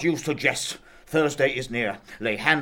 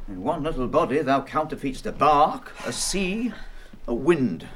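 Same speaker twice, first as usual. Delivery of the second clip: tender comforting; 6 semitones lower, 4 dB quieter than usual. Acted. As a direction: gently soothe a crying girl. Father comforting weeping daughter.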